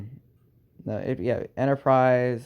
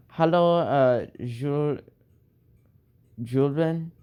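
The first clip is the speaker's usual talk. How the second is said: German attempt; 3 semitones higher, slower than usual. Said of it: "I know German".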